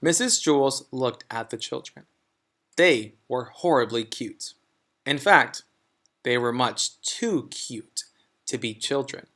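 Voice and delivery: plain reading voice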